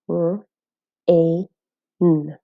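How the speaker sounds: "R, A, n" segmented for learners, each sound alone; sounding out phonemes. Shown as a demonstration. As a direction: say "R, A, n" as three separate sounds, pausing between. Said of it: "The sounds in the word are".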